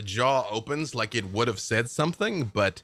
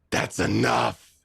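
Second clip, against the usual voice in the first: stern villain; 3 dB louder than usual. Acted. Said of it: "he just looks at you and says".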